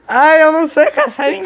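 high loud laughing protest; laughing dismay. Real, not acted. Picked as seeker, gasps.

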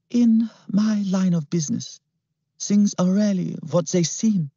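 high, silky sinister voice; smooth villain. A narrator's reading. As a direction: purr it with menace. Klimt voiced.

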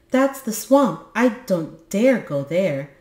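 high, scared little voice; frightened. Acted. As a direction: shrink back in fear. Little creature afraid of swamp.

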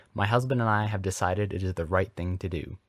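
ordinary delivery, nothing marked

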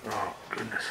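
normal talking voice

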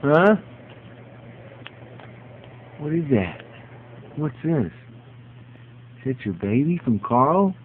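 high sing-song voice for the dog; high dog-talk voice. Real, not acted. Owner talks to dog Butters in high coo.

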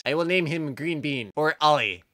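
ordinary talk

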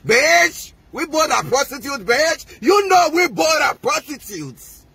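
a rival voiced in a high, jeering tone; mocking taunt. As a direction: throw the insult up high and mocking. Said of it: "I say".

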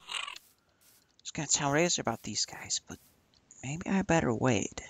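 hushed so they won't hear; whisper. Real, not acted. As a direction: whisper it, keep it secret. Sneaking near creatures, whispering.

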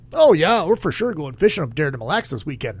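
mock Minnesota accent, quick patter. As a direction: put on an unattractive Minnesota accent. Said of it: "Minnesota accents are not attractive".

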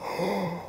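sharp, soft intake of breath; startled gasp. Acted. Baby Chick gasping at something in the dark. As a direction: catch your breath in fright. Sudden fright.

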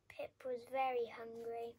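usual speaking voice

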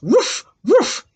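high doggy woofs; dog barks. Shown as a demonstration. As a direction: bark twice like a little dog. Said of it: "they say".

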